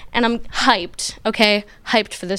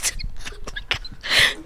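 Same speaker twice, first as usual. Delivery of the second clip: choked, breathy mock gasping; mock dying gasps; 7 dB quieter than usual. Child panicking as teacher calls mom, mock dying gasps. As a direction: gasp quietly as if dying of panic.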